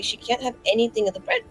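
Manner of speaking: normal talking voice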